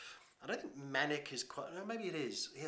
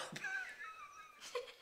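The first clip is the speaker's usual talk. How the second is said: high burst of laughter; 11 dB quieter than usual. Real, not acted. Laughing at own pub story.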